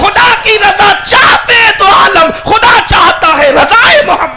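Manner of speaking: high, loud, building sermon cry; fiery preaching. Acted. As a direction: preach it to the rafters, rising each line. Preacher at full force.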